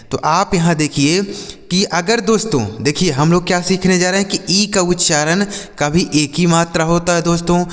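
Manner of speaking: ordinary talk, nothing marked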